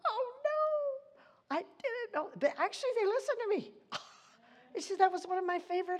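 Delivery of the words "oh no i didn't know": high, surprised mock reply; students' surprised reply, imitated high. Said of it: "And they went".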